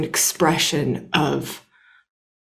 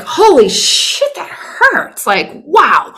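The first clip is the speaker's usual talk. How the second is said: high, slow pained cry of surprise; hurt exclamation; 7 semitones higher, much slower than usual. Retold or imitated. Woman voicing her own hurt to her partner, a high slow pained squeal. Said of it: "to show the environment, the whole thing of just like".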